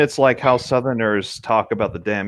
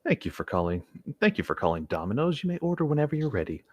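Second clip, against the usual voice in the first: softer, monotone phone greeting; mildly deadpan, softer call-center drone; dead inside voice; 2 semitones higher, 7 dB quieter than usual. Retold or imitated. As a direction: read it like you've given up on life. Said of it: "we also call it the dead inside voice".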